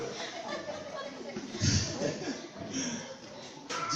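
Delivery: a long high airy laugh; real amused laugh, falling away; high breathy laugh. Real, not acted. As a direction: risa larga y aguda. Pastor laughs at wife's temper.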